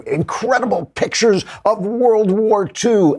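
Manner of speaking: high, slow, breathy-free hype voice of a clickbait ad; mock clickbait headline. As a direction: pitch it way up and stretch it like a cheesy ad. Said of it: "they say".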